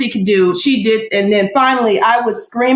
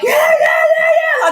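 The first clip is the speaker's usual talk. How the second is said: very high crowd cheer; finish-line cheering; slower than usual. Retold or imitated. Nurses cheering the mother at the birth, high excited cheer. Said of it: "Like I'm at the finish line".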